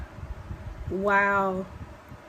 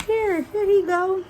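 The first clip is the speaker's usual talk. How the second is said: showing photo; 10 semitones higher, 4 dB louder, slower than usual. Retold or imitated. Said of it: "And she was just like".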